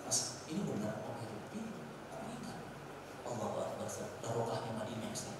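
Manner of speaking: low, very soft voices; hushed retold exchange. Pallbearer and jemaah marvel at the light coffin, hushed and low. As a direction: drop to an awed murmur.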